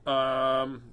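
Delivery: ordinary delivery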